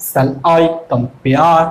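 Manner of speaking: "shall I compare" very slow and low, leaning on I and -pare; exaggerated iambic stress. Shown as a demonstration. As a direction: break "shall I compare" into drawn-out weak-strong syllables. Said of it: "যেখানে জোরে হচ্ছে".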